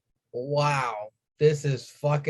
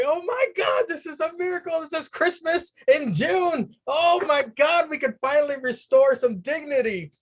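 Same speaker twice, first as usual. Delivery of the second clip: much higher, gleeful rave; overjoyed gush; 10 semitones higher than usual. Retold or imitated. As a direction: gush like it's Christmas. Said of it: "I was like".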